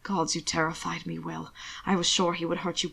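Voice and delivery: quiet tearful relief. Acted. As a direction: shaken almost to tears. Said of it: "tears standing in his amber eyes".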